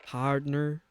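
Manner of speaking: clumsy southern drawl on one word; attempted southern accent. Shown as a demonstration. Streamer attempting a chatter's cowboy word, poor southern accent. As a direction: try a southern accent, not very convincingly. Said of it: "can't do a southern accent".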